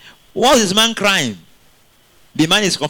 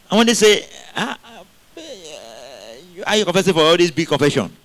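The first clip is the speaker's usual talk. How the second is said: ordinary talk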